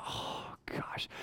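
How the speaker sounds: breathless whispered dread under his breath; a hushed oh gosh to himself. Retold or imitated. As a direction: whisper it as she walks up. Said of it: "And I'm going".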